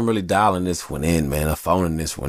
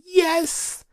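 screaming fans; much slower than usual. Retold or imitated. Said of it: "people screaming like".

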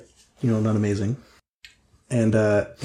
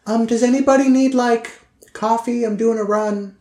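high-pitched, pointed character voice; Trixie, passive-aggressive; 11 semitones higher, 4 dB louder than usual. Acted. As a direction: pitch it way up with a put-upon edge. Game master voices Trixie.

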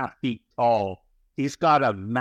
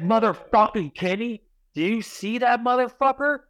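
much higher, agitated plea to look; desperate, pitched-up call to a friend; frantic urging; 10 semitones higher than usual. Retold or imitated. To his buddy Kenny about the gargoyle, high frantic urging.

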